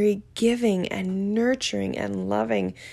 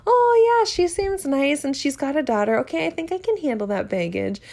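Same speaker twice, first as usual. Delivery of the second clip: mock suitor; 8 semitones higher than usual. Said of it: "people think".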